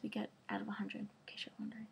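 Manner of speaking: soft unvoiced whisper, fading away; whispered aside. Real, not acted. Vlogger whispering her grades to viewers, hushed. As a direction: murmur it like a modest secret.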